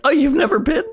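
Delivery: high-pitched, laughing self-imitation; retold awkward squeak; embarrassed high voice. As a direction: push the voice up, overly casual. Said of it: "this is fully my voice".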